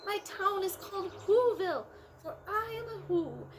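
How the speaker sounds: mayor of the speck, grateful; tiny Who voice. A narrator's reading. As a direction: speak as a tiny grateful person. Mayor of Whoville speaking.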